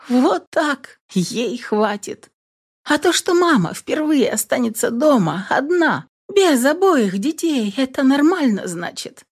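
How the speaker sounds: bitter irony, half to herself; sarcastic aside. A narrator's reading. Mother grumbling about being left alone, sarcastic.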